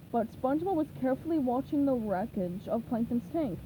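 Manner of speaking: plain reading voice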